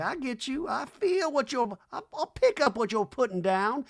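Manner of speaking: high knowing [name]; lightly hammed high [name] voice; [name] impression. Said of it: "She nods her head up and down like".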